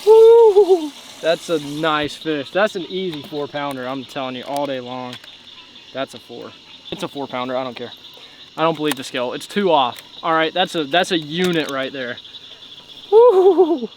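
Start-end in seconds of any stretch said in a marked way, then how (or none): 0.0-0.9 high, loud whoop; excited whoop. Real, not acted. Angler celebrating a landed bass at night, loud high whoop. 13.1-13.9 high, loud cheer; excited whoop. Real, not acted. Angler holding up a nasty-looking fish, loud high whoop. Celebrating another bass.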